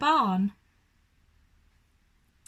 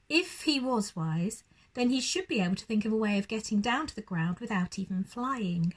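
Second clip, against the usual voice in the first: plain reading voice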